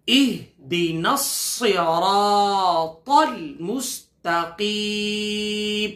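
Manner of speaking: slow, melodic Quranic chanting; slow tajweed recitation; full verse recitation. Shown as a demonstration. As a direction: recite the whole verse slowly and reverently. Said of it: "آیت کریمہ کو پورا پڑھ لیتے ایک ساتھ".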